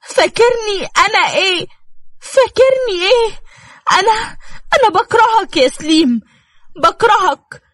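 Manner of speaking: high, crying and pleading hurt wife; tearful outburst. Acted. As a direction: sob it out at him in pain. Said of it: "بألم على وشه ودموعها مغرقة وشها".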